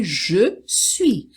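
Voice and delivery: je suis in full, as written; two separate words, je then suis; formal French pronunciation. Shown as a demonstration. Teacher showing the written form, full formal je suis. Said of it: "Inclusive você vai escrever assim".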